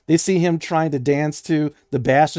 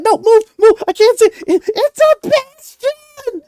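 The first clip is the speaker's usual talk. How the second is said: very high, loud impatient squeal; fanboying kid; 10 dB louder, slower than usual. Acted. Kid to fussing family blocking his view, frantic fanboy squeal. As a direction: shove them aside, then stretch out the awe. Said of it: "He's fanboying hard".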